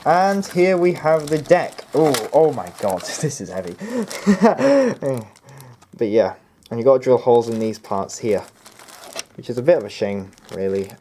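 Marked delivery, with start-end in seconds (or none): 3.8-5.2 high laugh. Real, not acted. Laughing at the heavy deck.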